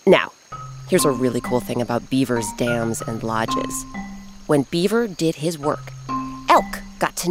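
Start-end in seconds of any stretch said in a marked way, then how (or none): none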